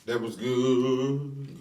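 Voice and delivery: drawn out, slow and relishing; slow savoring. Real, not acted. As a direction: savor every word slowly. Savoring the chicken.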